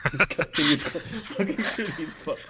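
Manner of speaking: high, laughing narration; real amusement breaking up the sentence. Real, not acted. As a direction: giggle through the words. Narrator laughing at own joke.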